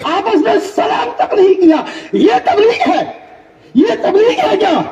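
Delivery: high, shouted rebuke from the pulpit; indignant preaching. Acted. Preacher rebuking preachers who ignore each other, indignant preaching. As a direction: scold the crowd with disbelief.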